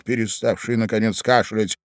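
plain reading voice

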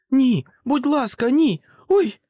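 very high, loud desperate scream; terrified pleading. A narrator's reading. Holly screaming as the monster grabs her. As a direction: beg in panic, then cry out. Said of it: "розпачливий і переляканий голос Голлі".

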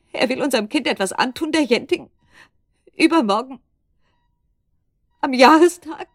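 höher und lauter bis zum Aufschrei; steigende Panik. Acted. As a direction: die Angst bricht sich Bahn, immer lauter. Angst um das Kind. (panisch, steigernd bis zum Ausruf)

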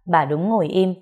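usual speaking voice

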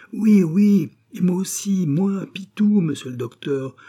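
high, eager cries, loud at first; breathless-to-be-noticed enthusiasm; excited calling. A narrator's reading. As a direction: burst in to be recognised. Pitou eagerly answers the prisoner.

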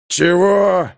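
angry retort. Acted. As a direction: bark out "Чего?" in anger. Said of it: "к удивлению прибавился гнев".

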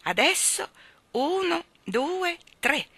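high, loud shouted countdown; loud count-off. A narrator's reading. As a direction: call the count to start the swings. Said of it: "gridò ad alta voce Michael".

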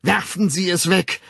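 loud, high demand; urging. A narrator's reading. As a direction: call it out urgently. Urgent demand, later quoted by narrator. (laut fordernd)

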